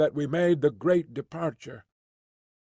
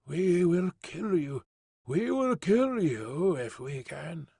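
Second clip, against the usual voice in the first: slow, faint, far-off menacing murmur; distant whisper; 2 semitones higher, slower than usual. A narrator's reading. Hidden tribesmen whisper threats at the explorers, slow and faint. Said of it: "the distant whisper".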